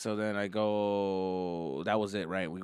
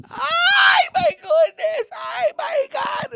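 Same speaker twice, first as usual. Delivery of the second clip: very high, loud mock shriek; screaming mom; 11 dB louder, much slower than usual. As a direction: shriek like a panicking mother. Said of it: "My mom is just".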